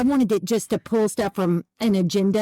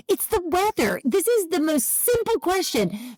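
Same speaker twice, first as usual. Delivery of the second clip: higher-pitched exasperated protest; retold exasperation; 7 semitones higher than usual. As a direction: protest the obvious, mad at the smart speaker. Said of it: "It makes me mad too".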